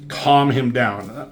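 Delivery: normal talking voice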